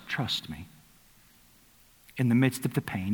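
soft, unhurried comfort; tender invitation. Retold or imitated. Preacher voicing God to the grieving, soft and tender. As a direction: gently call them to rest. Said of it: "He says".

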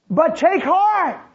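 high, loud, slow urging; emphatic encouragement. Retold or imitated. Jesus urging his disciples to take heart, slow and loud. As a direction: lift them up with the words. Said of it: "quoting Jesus emphatically".

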